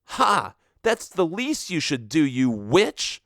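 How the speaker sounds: high scornful shout; shouting accusation. Acted. Diana insulting Daphne in the thunderstorm, high scornful shout. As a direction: sneer it, then fling the insult. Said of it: "shout accusations at each other".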